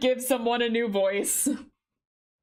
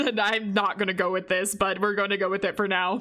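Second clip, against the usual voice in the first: ordinary talk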